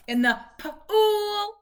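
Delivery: put-on mother modelling the phrase for her child; slow modelling. Shown as a demonstration. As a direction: stretch out "pool" for the child to copy. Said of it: "let's do two words".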